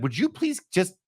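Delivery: high, pleading plea to get checked; pleading loved one. Retold or imitated. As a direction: beg gently, just for me.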